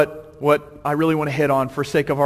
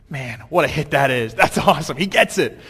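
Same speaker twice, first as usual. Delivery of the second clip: usual speaking voice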